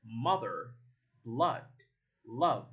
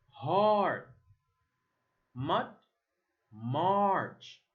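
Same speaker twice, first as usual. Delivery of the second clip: usual speaking voice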